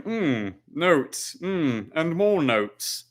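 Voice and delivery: mock-boring taster going through notes; boring tasting mumbo. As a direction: drag out the notes like a tedious taster. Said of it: "gonna get real boring".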